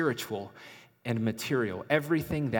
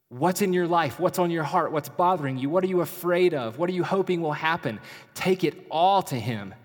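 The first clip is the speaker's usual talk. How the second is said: higher and louder, pressing each question home; preaching fervently; 5 semitones higher, 4 dB louder than usual. Acted. Preacher urging listeners to bring every worry, fervent and loud. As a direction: fire question after question at them.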